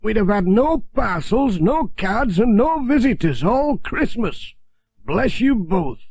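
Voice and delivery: put-on a grateful old countryman; Alf's voice. A narrator's reading. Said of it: "said Alf".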